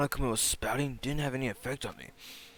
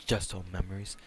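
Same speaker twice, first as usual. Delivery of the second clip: ordinary talk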